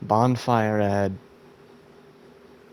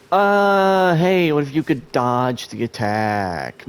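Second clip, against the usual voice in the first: louder, raised pitch at the game; exasperated shout at the screen; frustrated yell; 4 semitones higher, 5 dB louder than usual. Real, not acted. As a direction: scold the character for not dodging. Yelling at character not dodging.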